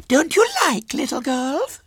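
put-on very high little girl voice; Violet Elizabeth. A narrator's reading. Small girl questions her visitor, high and childish. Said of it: "she said".

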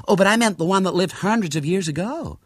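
high, emphatic American lady; excitable American lady tourist. A narrator's reading. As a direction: protest eagerly in a high voice.

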